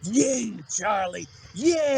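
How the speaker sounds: drawn-out, relishing yeah; getting off. Retold or imitated. Mimicking Billy thrilled as Charlie shoots, getting off. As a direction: imitate someone savouring the violence, slowly. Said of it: "he gets off on it".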